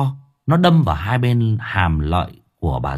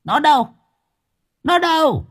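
shrill, high ghoul voice; frantic hag. Acted. Grandmother hunting for her granddaughter, shrill and frantic. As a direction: demand it wildly. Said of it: "ánh mắt láo liên".

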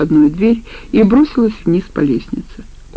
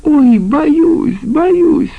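very high, mocking mock-fear singsong; brazen singsong; 10 semitones higher, 4 dB louder, much slower than usual. A narrator's reading. Woman taunts man holding a knife, brazen singsong. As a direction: sing it out insolently, pretending to be scared. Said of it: "нагло пропела она".

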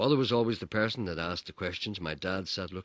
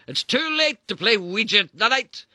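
slow, loud, much higher mock-casual drawl; flippant dad; 13 dB louder than usual. Dad flippantly teasing his wife, slow and high. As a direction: brush her off lightly. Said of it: "my dad said flippantly".

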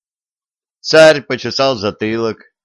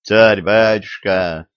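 usual speaking voice

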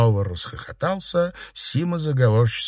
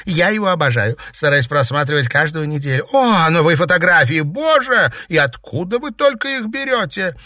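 high, loud fangirl gushing; gushing excitement; 7 semitones higher, 4 dB louder than usual. Acted. Girl gushing over new photos on the site, thrilled. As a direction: build to squealing delight.

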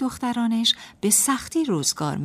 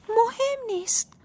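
high, drawn-out shrug in the voice; careless self-talk. A narrator's reading. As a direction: brush it off to yourself in a high voice. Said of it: "با خودش گفت".

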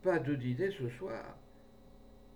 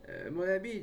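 slightly firmer, higher-pitched protest; a mild, higher objection with a little more backbone; less limp voice; 8 semitones higher than usual. A narrator's reading. Priest excusing himself to the man holding him, slightly less limp. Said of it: "d'une voix moins molle".

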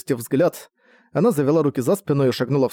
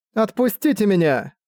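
higher-pitched plea to be released; protesting captive; 7 semitones higher than usual. A narrator's reading. Captive woman to her guards, protesting. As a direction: protest in a raised pitch, not shouted.

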